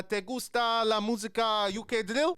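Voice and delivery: slow put-on Spanish accent; mock Spanish question. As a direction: ask it like a tourist in Mexico. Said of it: "just say".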